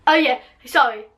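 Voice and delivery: usual speaking voice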